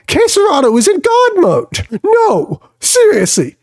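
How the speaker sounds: high, cracking excited shout; hyped. Real, not acted. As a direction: rave about the player, voice breaking up high. Hyping a star player.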